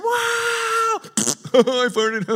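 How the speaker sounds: very high, hammed-up kid voice; silly middle schooler. Middle schooler blurting to the pastor, silly high voice. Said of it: "I would see it in middle school".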